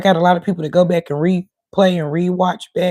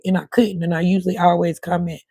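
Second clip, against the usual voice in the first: usual speaking voice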